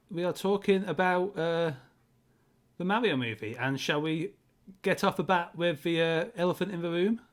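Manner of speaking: ordinary delivery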